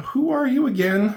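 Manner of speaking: high-pitched, loud query; puzzled questioning. Acted. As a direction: shoot up high in sudden doubt. Acted sudden puzzled question in dialogue.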